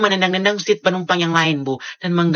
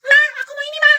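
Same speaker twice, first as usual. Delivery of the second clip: put-on high-pitched whining kid crying for a toy; whiny child; 8 dB louder, much faster than usual. Spoiled kid whining to mom at the store, crying. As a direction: wail like a spoiled child who wants it.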